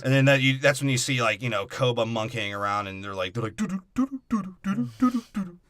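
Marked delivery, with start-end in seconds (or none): 3.6-5.6 higher singsong ape hoots; monkey hooting. Retold or imitated. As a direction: hoot like a goofy monkey, higher. Apes imitated monkeying around.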